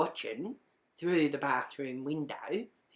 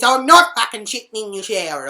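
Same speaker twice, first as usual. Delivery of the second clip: loud, higher indignant yell; shouted denial; 6 semitones higher, 13 dB louder than usual. Retold or imitated. Accused housemate yelling back through the door, indignant shout. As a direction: deny it at full volume.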